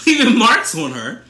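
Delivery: high, loud mock news reporter voice. Host showing off her reporter voice, high and loud. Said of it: "that's my news reporter voice".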